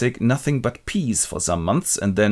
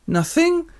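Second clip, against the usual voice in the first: much higher coy reply; high mock girl voice. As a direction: answer like a sulky girl. Acted quote as Marie.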